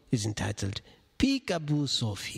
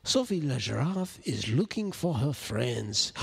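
ordinary delivery

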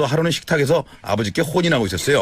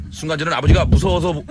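ordinary talk, nothing marked

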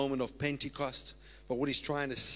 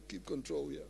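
much lower, words dissolving in laughter; laughing through words; 12 semitones lower, 8 dB quieter than usual. Real, not acted. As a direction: try to talk but laugh it off. Laughing while trying to compose.

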